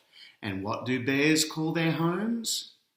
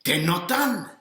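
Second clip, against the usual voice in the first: grumbling bear; 6 semitones higher, 4 dB louder than usual. A narrator's reading. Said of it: "he says".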